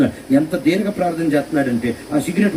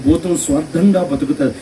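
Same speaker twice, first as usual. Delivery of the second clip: ordinary delivery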